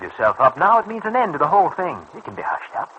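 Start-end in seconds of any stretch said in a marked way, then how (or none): 2.2-2.9 hushed, breathless whisper; whispered aside. Acted. Leroy, coaxing his partner, whispers the cover-up plan. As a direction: drop it to a secret whisper. Conspirator lowers voice on secret.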